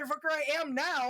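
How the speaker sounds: high-pitched indignant comeback; mock outrage. Co-host answering a creepy texter's question, high mock outrage. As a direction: blurt it back in mock alarm.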